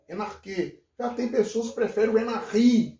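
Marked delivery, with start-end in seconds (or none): 0.1-0.8 "enarquê" with a hard k before final ê; reconstructed Attic pronunciation. Shown as a demonstration. Professor repeating his preferred Greek reading, "enarquê". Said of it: "existe a pronúncia ática reconstituída". 2.2-3.0 "enarri" with guttural r and stressed final i; Modern Greek pronunciation. Shown as a demonstration. Professor contrasting other readings, Modern Greek "enarri". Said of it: "que é o grego moderno".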